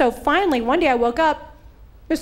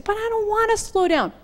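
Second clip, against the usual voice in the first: whiny protest; 10 semitones higher than usual. Retold or imitated. Quoting a reluctant listener.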